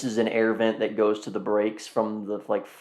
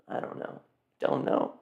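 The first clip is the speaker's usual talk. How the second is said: muttering; 9 dB quieter than usual. Real, not acted. Muttering to himself.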